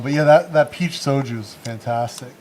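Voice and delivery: usual speaking voice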